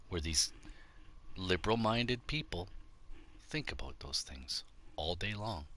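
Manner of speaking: ordinary delivery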